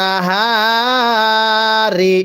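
নাহারি held very long, the হা stretched one alif; teacher's slow elongated নাহারি; drawn-out recitation. Shown as a demonstration. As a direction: draw out the হা in নাহারি slowly. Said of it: "এক আলিফ টানতে হয়".

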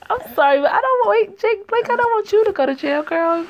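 high, loud pleading to the character. Real, not acted. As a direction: beg her to stop, half joking.

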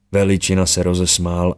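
plain reading voice

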